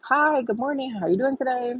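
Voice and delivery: polite sing-out good morning; cheery greeting. Retold or imitated. Retold friendly greeting to bus driver.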